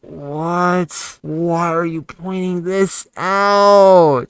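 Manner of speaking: builds from puzzled to a loud, slow, dragged-out yell; yelling at his past self in disbelief; exasperated shout. Real, not acted. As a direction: stretch the last words into a fed-up holler. Arguing with own old video.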